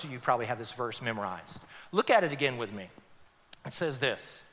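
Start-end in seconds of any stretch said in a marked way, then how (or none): none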